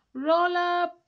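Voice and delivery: loud, high showman's call; salesman's cry. Acted. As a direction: bellow it like a market barker. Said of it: "he cried".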